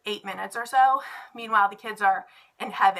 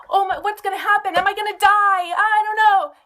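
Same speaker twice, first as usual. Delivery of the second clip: high, loud mock panic; nervous excited kids; 9 semitones higher, 7 dB louder than usual. Teacher voicing nervous kids before Pop Rocks, high mock panic. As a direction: play a kid half-scared of the candy. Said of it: "So they're excited, nervous".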